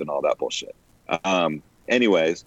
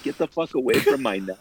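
bird voice; 6 semitones higher than usual. Retold or imitated.

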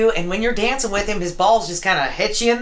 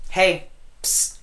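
psst for attention; 4 semitones lower, 4 dB quieter than usual. Acted. Secretive improv gossip opener.

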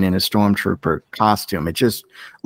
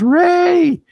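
high-pitched, stretched-out imitation scream; mock scream; 4 dB louder than usual. Fan mimicking Finn as Rey is taken, mock scream. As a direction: call the name in a much higher, mocking wail. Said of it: "he screams".

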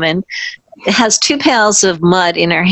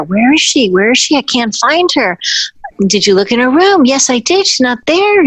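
higher, fretful mock searchers; searching voices; 7 semitones higher than usual. Company searching for the hidden dancer, lightly voiced worry. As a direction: call out as worried staff hunting her. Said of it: "and I hear".

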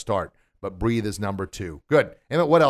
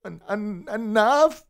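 high, stammered "enough"; hammed-up hesitant attempt, rising at the end; mock timid stutter, then a squeaked word; halfway-said demo; 10 semitones higher, much slower than usual. Shown as a demonstration. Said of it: "you don't halfway say it".

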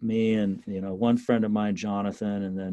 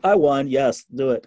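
triumphant; 4 semitones higher, 7 dB louder than usual. Retold or imitated. Triumphant after confrontation.

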